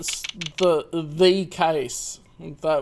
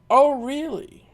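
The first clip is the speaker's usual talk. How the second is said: mock exclaim; 7 semitones higher, 3 dB louder than usual. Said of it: "my last name sounds like".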